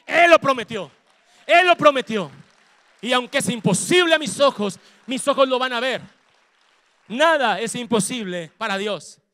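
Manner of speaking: loud, declaring shout; preaching shout. Real, not acted. Pastor shouting God's promise for family salvation. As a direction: proclaim the promise.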